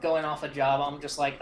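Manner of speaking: usual speaking voice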